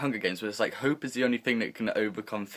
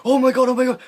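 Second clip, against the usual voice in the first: much higher and louder excited squeal; 14 semitones higher, 10 dB louder, faster than usual. Retold or imitated. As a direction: squeal like a kid who can't wait. Finally about to get presents.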